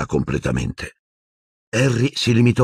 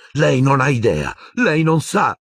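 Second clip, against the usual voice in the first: higher, rising bitter protest; angry; 8 semitones higher than usual. A narrator's reading. Grieving boy lashing out at calm headmaster, higher and angry. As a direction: accuse him, voice climbing.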